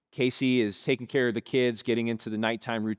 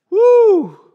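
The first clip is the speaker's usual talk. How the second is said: a loud, very high whooping cry; 18 dB louder than usual. Real, not acted. Preacher reacting to the congregation with a loud whoop. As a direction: let out a big whoop to the crowd. Reaction after being hung up.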